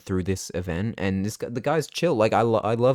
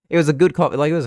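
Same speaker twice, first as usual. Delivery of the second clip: laughing it out, louder, higher and fast; 7 semitones higher, 6 dB louder, much faster than usual. Real, not acted. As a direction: giggle while defending the coffee. Laughs at the bad latte art.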